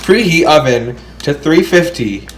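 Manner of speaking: flat robot voice reading instructions. Shown as a demonstration. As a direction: read it like a robot. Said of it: "You sound like a freaking robot".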